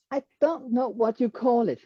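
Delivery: usual speaking voice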